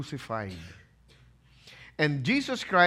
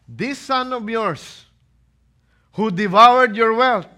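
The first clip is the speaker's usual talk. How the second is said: put-on bitter older brother; 8 semitones higher, 13 dB louder than usual. Elder son accusing the father, loud and bitter. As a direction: spit out "devoured" with contempt. Preacher voicing the resentful brother.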